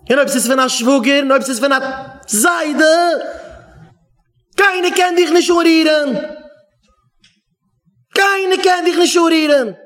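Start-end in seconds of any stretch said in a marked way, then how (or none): 4.6-9.8 high, emphatic rule with a falling end; emphatic declaration. Real, not acted. Speaker tells listener nobody may touch them, emphatic declaration. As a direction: declare it firmly, pitched up, then let it drop.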